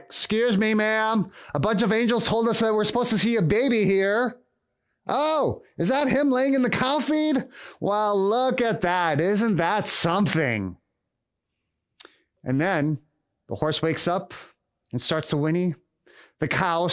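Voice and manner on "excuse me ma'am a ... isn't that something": high-pitched, put-on shepherd voice; shepherd visitor. Shepherds arriving unannounced to see the baby, high voice. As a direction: play an intruding shepherd, marvelling in a higher voice. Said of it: "the shepherds".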